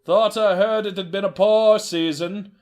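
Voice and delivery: a wary trader questioning a buyer; suspicious horse trader. Acted. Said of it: "said the horse trader".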